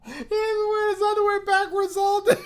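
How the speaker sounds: very high squealed laughing line. Real, not acted. Comic squealing laugh about underwear all day.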